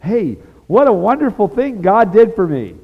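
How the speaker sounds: mock-bright joyful testimony; joyful exclaim. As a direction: burst out with happy praise. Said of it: "That's not a good way to say".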